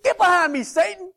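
retold sermon quote shouted at a well-meaning friend; mock rebuke shout. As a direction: bark it out like a theatrical exorcism. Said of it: "smacked him twice and said".

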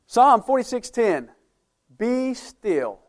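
loud emphatic reading. Acted. Preacher reads verse aloud.

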